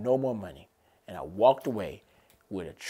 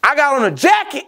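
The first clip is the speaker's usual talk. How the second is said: high, loud triumphant bragging; giddy shouted brag; excited boasting; 12 semitones higher, 5 dB louder than usual. Retold or imitated. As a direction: crow about it at the top of your voice. Excited rant about new ring.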